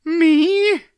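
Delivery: very high, loud frightened squeak; a boy terrified of being wed; panicked yelp. A narrator's reading. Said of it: "his knees beginning to tremble".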